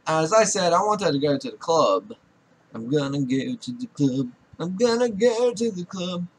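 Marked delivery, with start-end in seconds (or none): 2.7-4.3 playful low voice, dropped below usual; low put-on voice. Player announcing a club trip, low put-on voice. 4.6-6.3 playful voice pitched up high; high put-on voice. Player announcing a club trip, high put-on voice. Same line again, pitched up.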